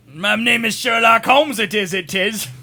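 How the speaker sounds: slow, loud, hammed-up British accent; bad British accent. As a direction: drag out the fake British intro. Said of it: "trying to do British".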